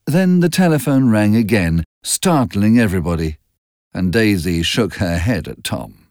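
plain reading voice

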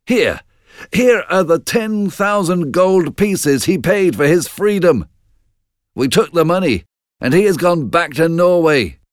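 high, proud report of victory; excited battle messenger. Acted. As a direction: announce the victory in a high, eager voice.

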